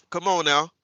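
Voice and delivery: ordinary talk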